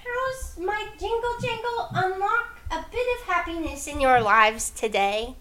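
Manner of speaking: high sweet little goblin voice; cutesy. Acted. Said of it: "she says ... her sweet little voice".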